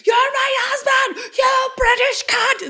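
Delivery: very high, loud, slow yell; mock Leary shout. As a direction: scream it as furious Leary. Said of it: "Leary is like".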